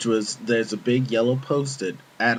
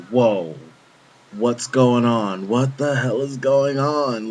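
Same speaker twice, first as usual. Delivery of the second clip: shocked disbelief; 2 semitones higher, 5 dB louder, slower than usual. Retold or imitated. Coworkers watching Oscar get cuffed, shocked disbelief. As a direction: draw it out, stunned and loud.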